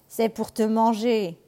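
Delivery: loud, slow wolf roar. Acted. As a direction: pounce with a big slow roar. Le loup bondit. (rugissement du loup, fort et lent)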